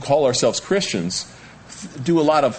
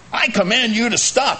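mock command; 11 semitones higher than usual. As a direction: bark the order from above. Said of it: "he doesn't say".

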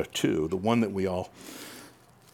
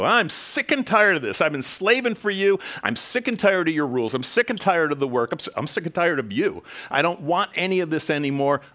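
put-on fed-up teenager; 6 semitones higher than usual. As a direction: rant at the parent like a fed-up kid. Acted rebellious teen to parent.

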